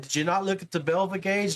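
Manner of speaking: ordinary talk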